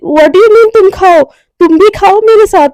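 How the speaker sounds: exasperated insistence. Acted. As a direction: scold a friend into joining in. Bossy friend scolds her, insisting.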